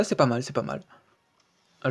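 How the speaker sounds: ordinary talk, nothing marked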